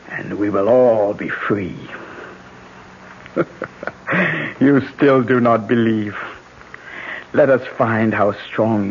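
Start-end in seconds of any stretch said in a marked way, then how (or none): 3.3-4.5 gloating laugh. Acted. Gloating after the curse.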